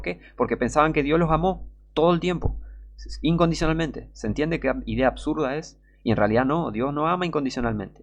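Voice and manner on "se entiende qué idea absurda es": ordinary talk, nothing marked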